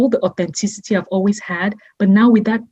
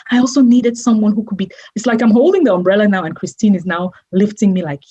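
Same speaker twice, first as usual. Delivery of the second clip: ordinary talk, nothing marked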